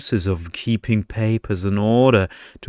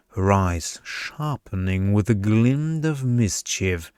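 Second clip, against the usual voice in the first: plain reading voice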